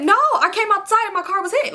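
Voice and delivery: high-pitched defensive insistence; retold insistent protest. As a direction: act out protesting, pitch jumping up. Insisting under friends' questioning, retold.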